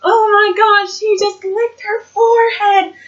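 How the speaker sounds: high, slow mock crowd shock; high drawn-out disbelief, imitating the crowd. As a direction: gasp it out like stunned fans. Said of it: "you hear everyone".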